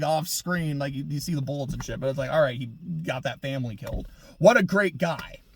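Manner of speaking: normal talking voice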